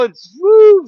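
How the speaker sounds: a high whoop as the ball flies; woo. Retold or imitated. Telling a joke on stream, a high whoop for the flying ball.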